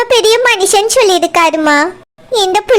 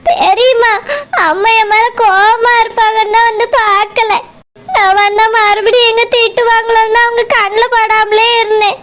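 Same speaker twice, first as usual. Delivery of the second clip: tearful confession, words broken by crying; sobbing explanation. Acted. Girl telling her aunt why she avoided her mother, sobbing. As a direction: explain yourself through sobs. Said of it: "நீ அழாத".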